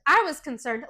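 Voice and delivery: usual speaking voice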